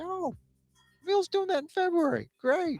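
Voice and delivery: very high, soft put-on voice; imagined calendar owner. Someone marking February on a new calendar, high put-on voice. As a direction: jump way up into a small falsetto voice. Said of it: "You say".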